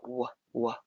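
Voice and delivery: "W, W" as two short separate "wuh" sounds; letter-sound demo. Shown as a demonstration. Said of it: "Maybe they're putting a W".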